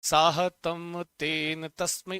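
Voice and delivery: slow, deliberate chant of साहतम तेन तस्मै; slow recitation. Shown as a demonstration. Teacher prompting students to repeat, chanting slowly. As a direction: lead the class through it slowly. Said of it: "एक बार बोलो मेरे साथ".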